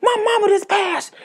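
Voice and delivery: very high, loud hysterical screams; screaming. Retold or imitated. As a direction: scream it out in a panicked meltdown. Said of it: "starts screaming".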